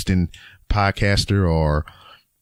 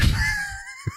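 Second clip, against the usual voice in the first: laugh; 6 dB quieter than usual. Real, not acted. Laughs after saying hungry.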